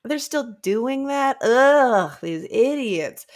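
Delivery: drawn-out disgusted sigh of contempt; slow scornful scoff. Retold or imitated. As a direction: drag it out like you're fed up.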